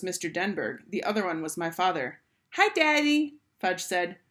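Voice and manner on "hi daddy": put-on high, louder toddler greeting; little boy. A narrator's reading. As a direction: chirp hello to daddy. Toddler Fudge greets his father.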